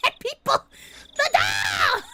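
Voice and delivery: loud high mock-outraged shout; re-enacted yell. Streamer showing how they yelled that week, mock shout. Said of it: "I was just yelling at people".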